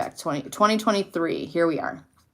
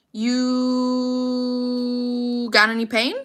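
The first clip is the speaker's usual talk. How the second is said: slow gentle question; 4 semitones higher, much slower than usual. Retold or imitated. Mother gently asking daughter.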